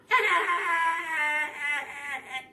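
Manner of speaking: high, loud witch cackle. A narrator's reading. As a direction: cackle with evil glee. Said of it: "she cackled".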